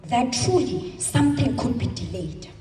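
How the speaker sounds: ordinary talk, nothing marked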